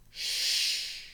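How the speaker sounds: whispered shush; man's shush. Acted. A man in the library shushing George, whispered. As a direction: hush him sharply but softly. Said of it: "said a man".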